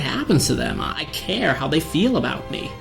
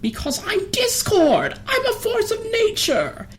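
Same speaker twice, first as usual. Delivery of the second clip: anguished outburst. Acted. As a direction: cry it out in despair. Despairing outburst.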